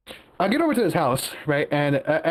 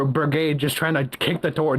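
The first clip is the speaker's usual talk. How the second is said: ordinary talk, nothing marked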